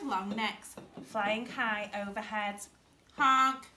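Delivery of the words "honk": loud duckling honk; calling out. A narrator's reading. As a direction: honk up to birds overhead. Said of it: "he called out to them".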